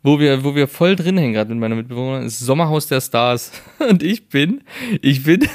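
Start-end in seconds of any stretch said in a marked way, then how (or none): 3.7-5.5 higher, laughing through the words. Real, not acted. Telling friend about the silly outing, laughing. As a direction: giggle while trying to tell it.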